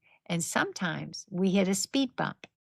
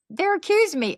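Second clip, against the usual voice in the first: high, loud panicked protest; freaking out; 11 semitones higher, 5 dB louder, faster than usual. Retold or imitated. Husband on the phone to his wife, in a high, loud panic. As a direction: imitate the husband's panic, pitched way up. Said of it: "He's freaking out".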